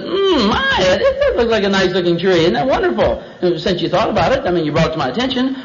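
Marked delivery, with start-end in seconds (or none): 0.0-3.2 high, put-on cooing wonder; hammed-up tempted delight; admiring Eve. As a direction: admire it with a lifted, savoring hum. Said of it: "She looked over and she said".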